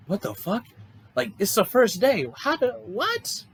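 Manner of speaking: high-pitched 'what on earth' reaction; baffled disbelief. Retold or imitated. Retelling own baffled reaction to test.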